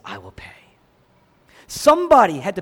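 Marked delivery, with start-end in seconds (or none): 0.0-0.6 low, soft, resolved promise; quiet resolve. Retold or imitated. Preacher voicing Jesus offering to pay, low and soft. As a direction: say it softly, firmly. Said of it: "He says".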